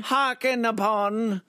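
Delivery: high, slow, loud theatrical voice; hammy stage actor. Acted. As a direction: declaim it grandly like a ham. Mock archaic stage banter.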